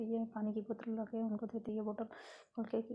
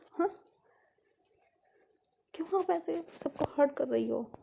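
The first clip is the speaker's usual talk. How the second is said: acted big-sister telling-off, pitch often raised; indignant scolding; 3 semitones higher than usual. Elder sister scolds Lovely for hurting Dad, indignant and raised.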